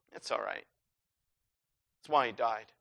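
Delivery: soft, low, offhand murmur; acted careless complacency; complacent shrug. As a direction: say it idly, as if nothing matters. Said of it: "bobbing around in the water and going".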